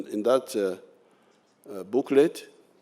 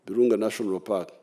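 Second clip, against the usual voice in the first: normal talking voice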